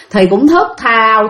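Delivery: ordinary talk, nothing marked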